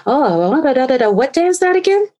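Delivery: high, sugary fake-sweet voice; fake nice. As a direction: act overly friendly to fish for info. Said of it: "I was really nice".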